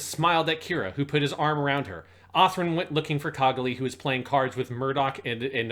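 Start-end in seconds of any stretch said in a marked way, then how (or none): none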